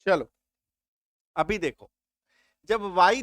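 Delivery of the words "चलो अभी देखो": ordinary talk, nothing marked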